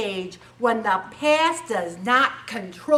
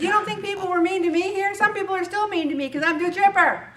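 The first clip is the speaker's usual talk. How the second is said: chipper pep talk; 9 semitones higher, 5 dB louder, faster than usual. Retold or imitated. Retelling her pep talk to coworker.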